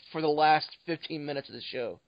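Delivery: normal talking voice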